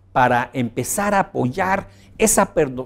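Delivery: ordinary delivery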